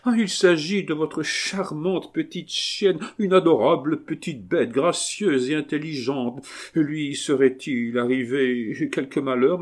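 high, gushing concern; mock despair. Abbé flattering the marquise about her dog, high and distraught. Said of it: "comme un homme désespéré".

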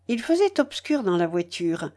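ordinary delivery, nothing marked

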